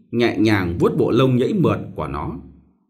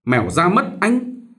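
put-on servant girl warning; 7 semitones higher than usual. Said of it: "Nó ngăn lại và nói thế".

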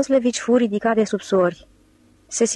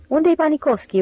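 calling out; 7 semitones higher than usual. A narrator's reading. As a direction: call out across the road, looking for him. Ostap looking for missing Panikovski.

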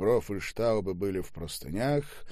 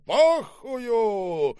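high, explosive roar of abuse; furious yell; 10 dB louder than usual. Acted. Boss hurls a bottle at the manager, furious yell. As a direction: scream it as you hurl something. Curses while throwing bottle.

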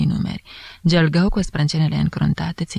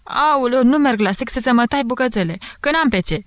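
țipăt mult mai sus, de femeie speriată; strigă; 7 semitones higher than usual. A narrator's reading. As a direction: Aoleu strigat ascuțit, ca apucată. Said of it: "strigă ea". (servitoarea strigă ca apucată de furie, pe ton înalt)

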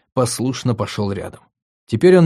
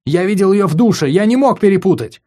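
loud, high, pounding insistence; insistent outburst; 8 semitones higher, 5 dB louder than usual. Acted. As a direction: hammer the point home. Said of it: "стукнул ладонями по одеялу".

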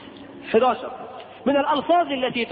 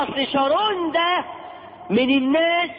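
mock gossip voice; 7 semitones higher than usual. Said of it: "يقول له".

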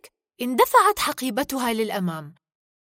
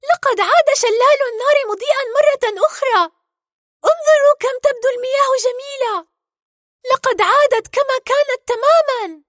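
very high, loud, thrilled heroine voice; excited announcement; 10 dB louder than usual. Acted. Merida announces the restored waterfall to all, excited. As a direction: call out good news with great excitement. Merida joins in calling good news.

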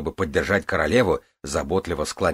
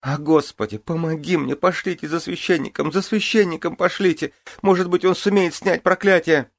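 very high desperate cries; panicked pleading; 11 semitones higher than usual. Acted. Queen in labour begs for priest.

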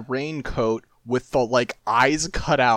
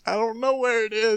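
high sheepish voice; 8 semitones higher than usual. Retold or imitated. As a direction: admit it like a caught kid. Imitating Jinho caught out.

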